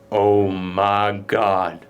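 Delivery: very slow, low "oh my God"; mock awe. They find clippings of horrendous murders, slow stunned awe. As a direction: draw it out, dropped low, as if stunned.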